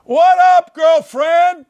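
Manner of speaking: very high, loud greeting; exuberant shout. Pretend. Host greeting his guest Haley, exuberant high shout. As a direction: holler a hello in an over-the-top pitch.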